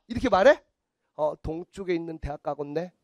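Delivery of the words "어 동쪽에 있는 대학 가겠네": low, soft vague pronouncement; mock fortune teller. Fortune teller guessing a client's university, low and noncommittal. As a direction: murmur a vague prediction.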